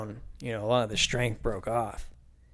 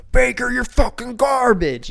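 much higher, louder, slow mock heckle; 12 semitones higher, 7 dB louder than usual. Fan heckling the Buccaneers quarterback, hammed-up yelling. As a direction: jeer like a rowdy fan in the stands.

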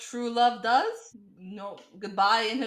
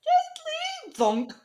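mock gentle thunk; much slower than usual. Narrating the Wesley knife scene.

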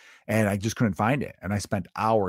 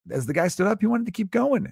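excited; 11 semitones higher than usual. Real, not acted. Excited storytelling.

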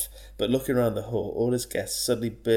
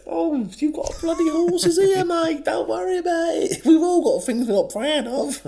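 very high, loud, hooting reassurance; hammed-up cheery guests bellowing comfort; jolly guests; 7 dB louder than usual. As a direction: shout it like a merry crowd of guests.

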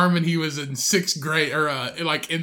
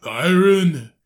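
Arnold accent; 7 dB louder than usual. Shown as a demonstration. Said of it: "that's how he'd say Myron".

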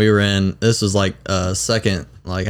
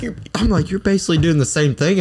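laughing retort; 9 semitones higher than usual. Retold or imitated. Quoting himself to the girl, laughing.

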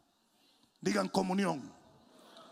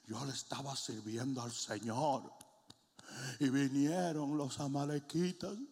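acted lament; 2 semitones lower than usual. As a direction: begin hushed, as if bewildered by loss. Acts disgruntled people complaining to God.

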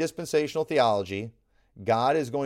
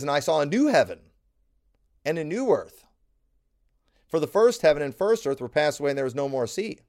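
forceful scripture reading; 3 semitones higher, 5 dB louder than usual. Acted. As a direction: proclaim the verse loudly, leaning on new and first. Reads Revelation with force.

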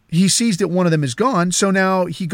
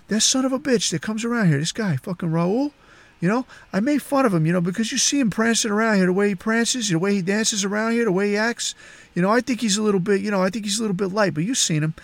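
higher, hurried conspiratorial patter; confiding boss; 4 semitones higher, 4 dB quieter, faster than usual. Retold or imitated. Boss gossips to housekeeper about the helper, quick confiding voice. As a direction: rattle off gossip as if between two people.

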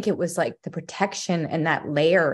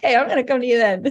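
higher, louder and fast teasing voice; playful mock voice; 8 semitones higher, 6 dB louder, much faster than usual. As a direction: rattle it off as the cheeky returning thought. Joining the joke as the thought.

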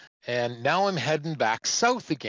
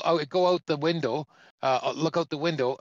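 ordinary talk

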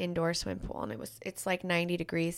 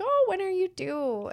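1 lightly voiced cheerful stepdad; 12 semitones higher, slower than usual. Stepdad voiced asking cashier.

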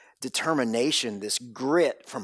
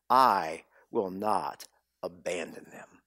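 whispered, much lower and softer; hushed promise; 8 semitones lower, 5 dB quieter than usual. Acted. Preacher whispering God's promise to the congregation. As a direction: breathe the promise like a secret.

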